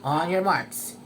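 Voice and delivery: usual speaking voice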